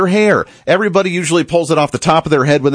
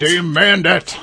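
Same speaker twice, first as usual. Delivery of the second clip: mock echo; 3 semitones higher, much slower than usual. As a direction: parrot it back with a sneer. Mocking echo of the clip.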